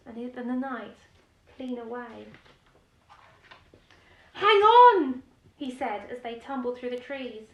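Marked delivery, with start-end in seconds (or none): 4.3-5.2 loud, high cry to hold tight; shouting. Acted. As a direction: yell it while tumbling away. Knight tumbling through the trees.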